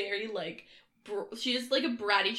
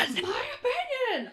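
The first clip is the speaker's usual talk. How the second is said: playful echo shout. Real, not acted. Echoes co-host's shout.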